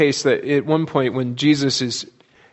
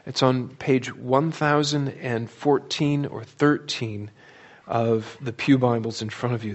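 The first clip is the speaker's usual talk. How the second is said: ordinary delivery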